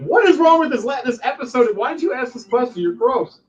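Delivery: high-pitched scandalized complainer; hammed-up, high indignant protest; mock outraged audience. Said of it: "People are gonna be like".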